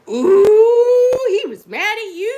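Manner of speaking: high, loud teasing "Ooh" that drops off; teasing husband. Retold or imitated. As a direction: tease her like a gleeful husband. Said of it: "My husband was like".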